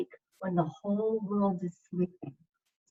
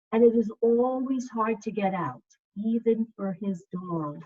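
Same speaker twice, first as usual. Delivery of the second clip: gentle, slow storybook reading; reading to children; 6 dB louder, slower than usual. Acted. Second grade teacher reading a picture book to her class, soothing.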